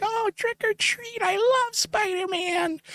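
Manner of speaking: put-on very high, slow squealing child voice; mock excited kid. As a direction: gush like a thrilled little trick-or-treater. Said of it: "He's all excited".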